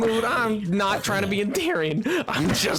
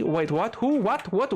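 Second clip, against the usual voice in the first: usual speaking voice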